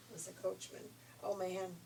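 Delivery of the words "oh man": usual speaking voice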